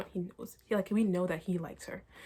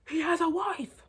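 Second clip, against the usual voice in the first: higher, louder snippy put-on voice; mock jealous guy; 9 semitones higher, 6 dB louder, slower than usual. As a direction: imitate the jealous coach snapping.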